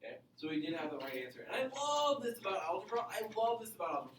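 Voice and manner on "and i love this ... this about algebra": "love" sung out with real delight; excited about algebra. Real, not acted. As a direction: gush about your favorite topic. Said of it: "I get excited about it".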